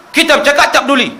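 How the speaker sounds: mock-angry elder telling off a child; scolding elder. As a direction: bark at the kid like a grumpy mosque elder. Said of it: "Dia marah budak-budak".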